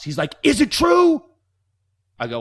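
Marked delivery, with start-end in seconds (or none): 0.4-1.2 high, loud demanding shout; principal's yell. Retold or imitated. Principal storming out at the student, high shouted demand. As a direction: bellow it like an outraged principal. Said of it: "barreling out of the office".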